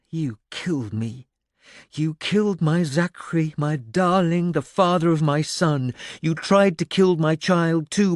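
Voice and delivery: high, loud echoing grievance; ghostly accusation. A narrator's reading. A dead woman's ghost accuses the old man who killed her lover, bitter and loud. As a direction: accuse him from beyond the grave.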